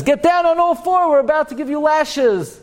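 high-pitched barked-out mock order; hammed-up court summons, voice pushed way up; commanding court officer. Court ordering a sinner down for lashes, mock commanding.

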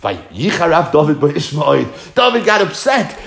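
normal talking voice

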